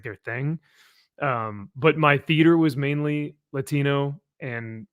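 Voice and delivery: normal talking voice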